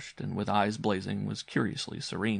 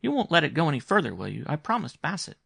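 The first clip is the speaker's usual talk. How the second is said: put-on higher, quicker little-boy voice; earnest young boy; 7 semitones higher, 4 dB louder than usual. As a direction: plead with uncle as an eager child. Boy pleads with uncle in dialogue.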